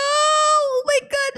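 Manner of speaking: slow drawn-out shocked shout; oh my God, nearly fell; startled cry. Real, not acted. Almost falling.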